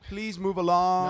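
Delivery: loud, drawn-out imitation of a polite order; slow, projected mock courtesy; mock-polite request. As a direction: stretch it out as a mock civil request. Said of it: "Not like".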